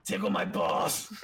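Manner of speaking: low, loud, slow put-on voice; hammed-up low croak, drawn out; joke voice. Clowning for friends after the steroid joke, silly put-on voice.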